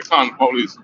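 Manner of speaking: usual speaking voice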